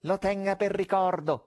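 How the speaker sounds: mocking stage magician; conjurer's call. A narrator's reading. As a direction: toss it out to the stalls.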